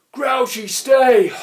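high, loud troll bellow; panting shout. A narrator's reading. Grunt shouts at Grouchy, panting loudly. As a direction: shout it out of breath. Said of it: "panted the grunt".

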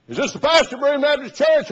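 high and loud, prissy caller; mock caller voice. Preacher imitating the Southside pastor calling him. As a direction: put on the pushy pastor on the phone.

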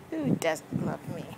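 usual speaking voice

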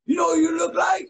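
high, loud excited shout; excited strangers. Retold or imitated. Strangers on the street shouting excitedly at him. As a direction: call out like spotting a celebrity. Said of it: "everybody's like".

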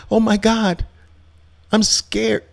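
mock scared. Trader scared of imagined losses, hammed-up high fright. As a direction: parody the panicking trader. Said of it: "and then you start".

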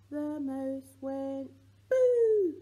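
loud, slow, laughing "boo" to startle; mock scare in a nursery rhyme, laughing; playful boo. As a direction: jump out at the baby with a laughing boo. Surprise moment in rhyme.

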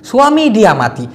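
ordinary talk, nothing marked

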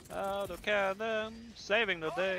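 loud, slow, high hero shout; hammed-up triumphant battle cry; heroic call-out. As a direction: bellow it like a comic-book hero.

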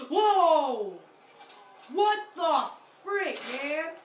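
loud startled whoa; startled shout. Real, not acted. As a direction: jump at a sudden scare. Sudden near death in game.

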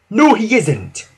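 high, slow, firm contradiction; determined old-engine retort; loud whistle. A narrator's reading. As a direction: speak up firmly and drawn out. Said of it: "whistled Freddy loudly".

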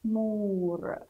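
تمور split slowly as tu, pause, muur; syllable-by-syllable reading. Shown as a demonstration. Teacher models reading, تمور slowly in two parts. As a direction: stretch each syllable of تمور. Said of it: "نقرأ".

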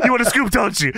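high, hammed-up wrestler impression; Booker T. Wrestler teasing the interviewer, high hammed-up impression. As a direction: push it high, playfully imitating the champ. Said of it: "He said".

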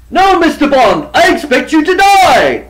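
high, loud villain threat with a shout; Bond villain. Retold or imitated. Villain threatening Mister Bond, loud and gloating. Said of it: "You wanna hear him say".

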